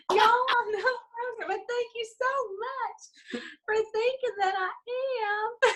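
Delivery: high, slow, exaggerated Texas drawl accent. Convincing hotel guards she's a tourist, slow Texas accent. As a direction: lay the Texas accent on thick. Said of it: "turned up the Texas accent".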